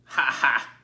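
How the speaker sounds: loud bleating laugh; bleating lamb laugh. Acted. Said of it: "bleated a big lamb".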